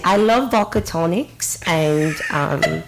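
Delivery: slow put-on British accent; mock-British bar chat, drawn out. Shown as a demonstration. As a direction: show off a pretend British accent. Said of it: "This is the accent".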